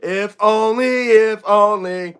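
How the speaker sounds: measured, lilting repeat without the howl; drawn-out poem ending. Acted. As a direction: stretch both phrases evenly to close the verse. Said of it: "how I wanna end it".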